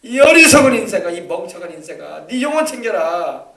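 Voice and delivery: the Lord shouting at a foolish man; loud rebuke. Acted. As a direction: call out to the fool. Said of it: "큰 소리를 했을 겁니다".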